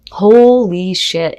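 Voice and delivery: drawn out slowly in disbelief; slow stunned curse. Real, not acted. Tarot reader stunned as the Tower card appears. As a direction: let it sink in, slow and loud.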